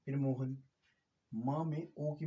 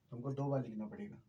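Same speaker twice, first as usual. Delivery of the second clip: ordinary talk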